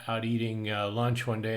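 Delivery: ordinary talk, nothing marked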